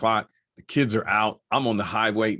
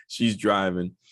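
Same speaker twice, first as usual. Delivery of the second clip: usual speaking voice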